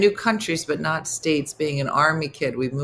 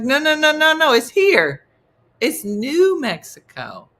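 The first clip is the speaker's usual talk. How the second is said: exasperated correction; 10 semitones higher, 7 dB louder than usual. Retold or imitated. Correcting Montanans about New Mexico, loud exasperated protest.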